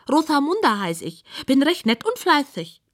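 high-voiced pig introducing herself; high, sing-song pig; rhyming pig. A narrator's reading. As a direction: recite it in a high piggy voice.

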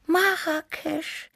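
high, slow, dreamy cat voice; wistful little cat, lingering on it. A narrator's reading. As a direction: draw the word out dreamily, way up high. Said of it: "wiederholte Tibi verträumt".

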